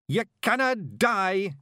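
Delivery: high, loud, slow broad Scots threat; Scottish battle yell. Acted. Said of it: "he yelled".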